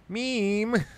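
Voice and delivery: high, put-on child's cry; kid calling. Kid calling mom Meemaw, high and loud. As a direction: call out like a whiny kid. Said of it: "kids out there probably calling their mom".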